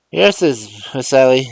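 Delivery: put-on high, loud old man's dialect voice; old servant astonished at the talking box; amazed old Uncle Remus. Uncle Remus asks Mars John through the phone, amazed.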